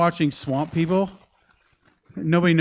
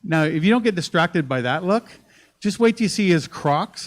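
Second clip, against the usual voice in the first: normal talking voice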